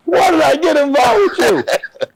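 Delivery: very high, drawn-out mock lament; exasperated wail. Teammate just suspended along with him, high exasperated wail. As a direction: imitate a pal wailing in dismay.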